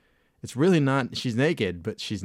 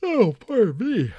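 talking through a big real yawn; high, stretched words swallowed by a yawn; 13 semitones higher, 7 dB louder than usual. Real, not acted. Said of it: "A big yawn".

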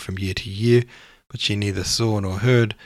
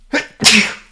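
sudden sharp cough; 13 semitones higher, 10 dB louder than usual. Real, not acted. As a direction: cough once, loudly. Excuse me.

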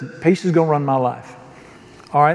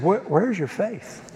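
gentle rebuke; 8 dB quieter than usual. Retold or imitated. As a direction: ask it gently, like a patient teacher. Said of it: "He said".

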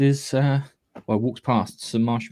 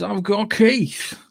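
announcer; 9 semitones higher, much slower than usual. Acted. Grand host introduction.

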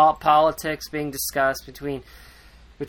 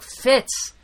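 angry scolding; 6 semitones higher than usual. Retold or imitated. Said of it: "Chade gets pissed".

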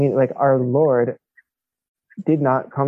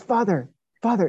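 raised pitch, urgent and eager call; 8 semitones higher than usual. Retold or imitated. Italian stranger urging the priest to notice, raised urgent call. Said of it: "he says".

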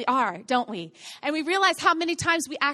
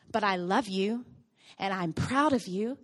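tender reassurance; 7 semitones lower, 3 dB quieter than usual. Retold or imitated. Father reassuring daughter.

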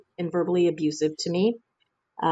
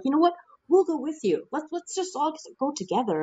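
much higher, lightly voiced cheerful friends; eager friends; 9 semitones higher than usual. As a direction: chirp it like keen friends signing on. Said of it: "they're like".

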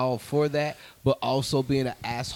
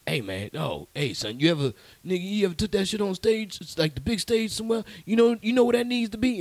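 put-on pitched up, pushy old man urging him on; hammed-up older man, higher and louder; older gentleman; 8 semitones higher, 4 dB louder than usual. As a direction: lean in like an insistent older guy hyping a young comic. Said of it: "this guy came up to me, he was like".

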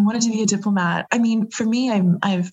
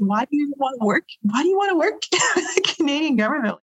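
high, laughing retelling of his question; laughing while quoting; 9 semitones higher than usual. Retold or imitated. Retelling the security chief's baffled question to the host, laughing. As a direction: giggle through his puzzled question. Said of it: "he's like".

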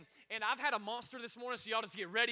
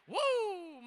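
excited woo; 8 semitones higher, 5 dB louder than usual. Real, not acted. Crowd answers patience, he reacts.

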